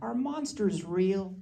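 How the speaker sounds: put-on high, soft, slow child's question; sweet little daughter. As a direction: imitate a small girl asking sweetly. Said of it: "she says to me the sweetest thing".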